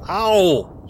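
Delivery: high sharp ouch; pained yelp. Acted. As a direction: yelp as slapped. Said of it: "He slaps him".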